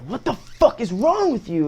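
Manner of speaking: high, slow mock disbelief in a put-on voice; high, drawn-out imitation of an outraged student; mock outrage. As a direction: stretch it out like you can't believe them. Retold outburst at staff.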